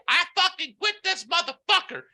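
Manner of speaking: high-pitched, outraged outburst; imitated furious quitting. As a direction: shriek it like she's storming out. Acts out manager quitting in fury.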